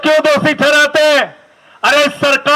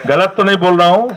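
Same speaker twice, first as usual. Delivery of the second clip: fast, lower, rising tag question; fast lower rising aside; quick aside; 7 semitones lower, much faster than usual. Real, not acted. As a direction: rattle it off under the breath to the crowd. Rhetorical check with the crowd.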